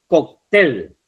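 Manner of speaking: "Cóctel" with the weight on "tel"; stress on last syllable. Shown as a demonstration. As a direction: lean on the final syllable of "Cóctel". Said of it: "la sílaba tel".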